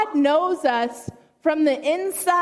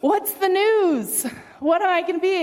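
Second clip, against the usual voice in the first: eager snake; 5 semitones higher than usual. Acted. Snake asking God for news of its creation, eager. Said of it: "Snake goes into his room with God and says".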